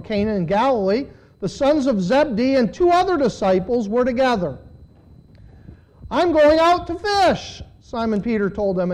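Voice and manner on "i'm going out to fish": lightly acted announcement, raised and drawn out; announcing. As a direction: declare it slowly, pitched up. Reading Peter's announcement in scripture.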